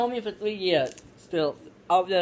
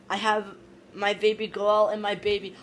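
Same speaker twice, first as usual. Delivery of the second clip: usual speaking voice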